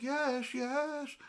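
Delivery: high-pitched, contained glee; delighted celebration. Retold or imitated. Shopper spotting the last hot chicken, delighted celebration.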